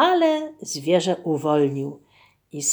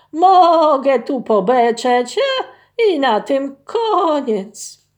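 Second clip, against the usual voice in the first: slow, higher and louder bleating ram voice; 6 semitones higher, 7 dB louder, slower than usual. Acted. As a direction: bleat the words like a dull ram. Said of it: "Ten beknął". (beczący baran, powoli i głośniej)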